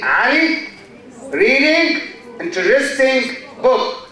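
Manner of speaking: "Ali", "reading", "interesting", "book" each stressed and set apart; content words only. Shown as a demonstration. Teacher shows what listeners hear, content words stressed one by one. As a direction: punch out only the content words, pausing between them. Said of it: "You don't hear is or you don't hear a".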